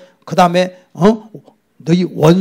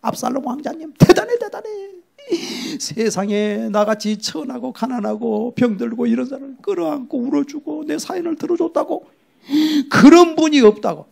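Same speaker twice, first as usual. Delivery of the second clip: mock gushing praise; 6 semitones higher than usual. Fooled people praise prince.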